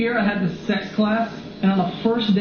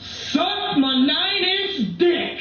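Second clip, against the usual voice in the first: high, slow drawn-out yell of a crazed man; mock scream; 7 semitones higher, much slower than usual. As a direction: stretch it out like a ranting stranger on the train. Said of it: "he just screamed".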